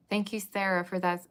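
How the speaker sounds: loud, bursting thank-you to a viewer; excited thanks. Real, not acted. Thanking Sarah for her gift in the live chat, loud and excited. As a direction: shout your gratitude, thrilled.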